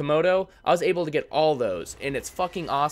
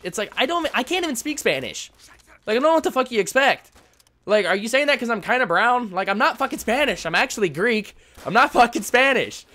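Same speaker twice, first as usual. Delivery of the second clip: higher, louder exasperated outburst; frustrated rant; 9 semitones higher, 5 dB louder than usual. Real, not acted. Venting at the sponsor's Spanish request, frustrated and loud. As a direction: boil over, loud and high. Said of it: "it just got so fucking frustrating".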